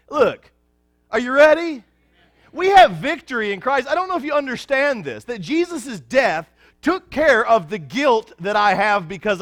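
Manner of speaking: high, loud ringing preaching voice; full-force preaching. Acted. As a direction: proclaim victory to the congregation, full power.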